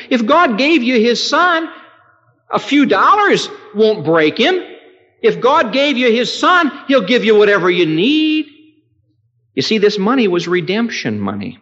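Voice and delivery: high, loud revival cry; fervent preacher proclamation; preaching at full force. Acted. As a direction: proclaim it with fire. Climactic pulpit preaching.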